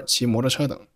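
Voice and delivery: ordinary talk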